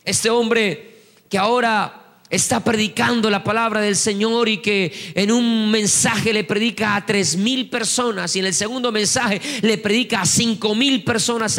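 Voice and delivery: ordinary talk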